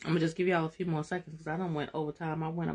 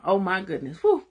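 ordinary talk

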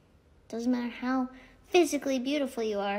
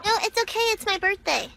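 higher, bratty sing-song; cheeky; 8 semitones higher, 5 dB louder than usual. Pretend. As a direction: claim it like a spoiled kid. Playful birthday claim.

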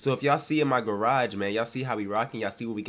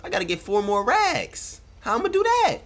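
shocked exclaim; 9 semitones higher, 12 dB louder, slower than usual. Real, not acted. Checking his bank balance.

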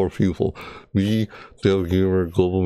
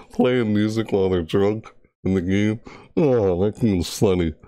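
normal talking voice

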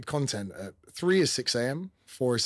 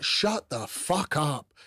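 slow, drawn-out put-down pitched high; mock housemate put-down; 5 semitones higher, much slower than usual. Housemates shutting down his TikTok brag, in a slow mocking drawl. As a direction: drag out the brush-off like a bored housemate.

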